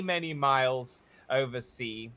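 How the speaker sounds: ordinary delivery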